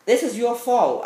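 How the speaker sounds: loud, high-pitched blaming outburst; acted accusation. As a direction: throw the blame at her, loud and high. Retold rebuke aimed at Catwoman.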